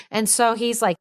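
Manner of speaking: ordinary delivery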